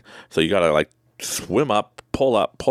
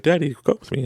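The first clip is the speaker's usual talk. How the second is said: put-on child voice; 9 semitones higher than usual. Son voiced in a story.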